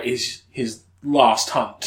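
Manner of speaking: usual speaking voice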